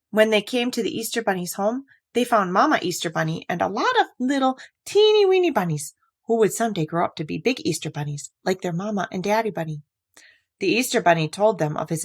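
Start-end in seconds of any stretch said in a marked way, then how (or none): none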